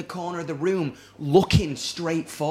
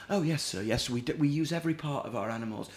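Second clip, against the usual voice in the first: hushed waiter; 8 dB quieter than usual. Retold or imitated. Imitating the waiter's hushed answer.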